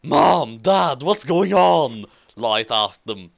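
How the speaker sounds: ordinary delivery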